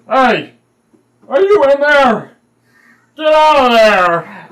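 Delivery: high, drawn-out loud holler; hulking man shouting. Acted. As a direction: shout slowly into a hole to scare out whatever hides. Bron yells into rat hole.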